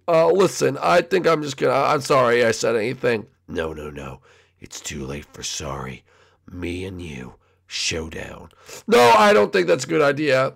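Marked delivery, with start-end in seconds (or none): 3.5-8.5 very low, soft menace; dark low murmur; menacing Shadow. Acted. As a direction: issue a quiet, deep challenge. Shadow challenges Junior.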